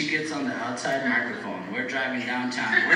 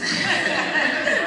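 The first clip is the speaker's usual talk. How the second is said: a high burst of real laughter; 4 dB louder than usual. Real, not acted. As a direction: laugh out loud at yourself. Laughs at own name.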